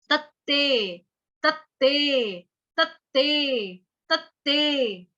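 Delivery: तत्ते drawn out slowly, doubled त held; slow syllable drill. Shown as a demonstration. Teacher drilling the Sanskrit word तत्ते for students, slow and careful. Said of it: "पहला वाला त पूरा".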